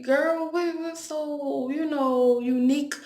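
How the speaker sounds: retold incredulous reply. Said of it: "I was like".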